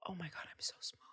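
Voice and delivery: hushed, awestruck whisper of feeling tiny; awed whisper. Retold or imitated. Whispering to a friend about feeling small before faith, awed hush. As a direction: breathe it out, overwhelmed by something huge. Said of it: "It's like this feeling of".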